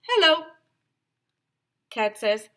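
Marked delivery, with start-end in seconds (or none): 0.0-0.5 high, loud dog greeting; dog character. Acted. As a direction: call out a high-pitched hello as the dog. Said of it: "So dog says".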